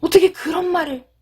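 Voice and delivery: high, acted hurt reproach; 감정이 상한 듯. Shown as a demonstration. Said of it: "여러분 막 감정적으로 상했을 때". (서운하고 상처받은 듯 높은 목소리)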